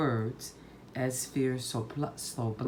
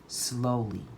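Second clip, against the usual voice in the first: ordinary delivery, nothing marked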